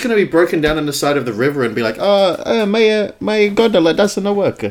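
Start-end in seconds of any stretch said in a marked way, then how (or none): 2.0-4.6 high-pitched, hammed-up Italian accent; bad Italian accent. Stranded boatman lamenting his broken gondola, in a bad Italian accent. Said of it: "That's the worst Italian accent of all time".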